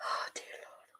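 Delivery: very soft, breathy whisper; dreading whisper. Real, not acted. Fan fearing a goal whispers, dreading. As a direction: hardly voice it.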